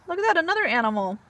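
usual speaking voice

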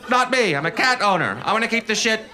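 sneering parody of a cat owner; smug cat owner. Retold or imitated. Mocking cat owner persona.

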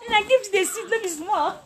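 real giddy joy, squealing thanks; overjoyed. Real, not acted. Teacher overwhelmed by a viewer's gift, high laughing tearful joy.

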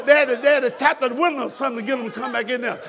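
usual speaking voice

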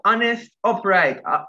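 ordinary talk

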